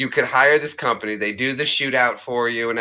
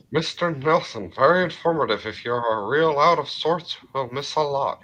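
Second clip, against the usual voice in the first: Hank Hill; 4 semitones higher than usual. Acted. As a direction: impersonate an earnest cartoon dad, pitched up. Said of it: "I'll keep it going just for Val".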